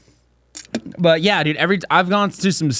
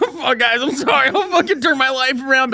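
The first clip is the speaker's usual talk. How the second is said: higher, laughing apology; mock scared robber; 10 semitones higher than usual. Burglar apologizing to the armed homeowner, laughing. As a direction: plead like a terrified burglar. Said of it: "I'd be like".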